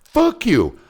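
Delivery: loud, high indignant burst; mock outrage. Grown son reacting to Dad's trust trick, loud mock outrage. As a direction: imitate a son's outraged comeback. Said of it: "this is what Brad tells me".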